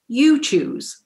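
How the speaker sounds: "you" pitched high, then falling; stress on "you". Retold or imitated. Director returning the choice to the auditioner, insistent stress on "you". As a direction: throw it back, leaning high on "you".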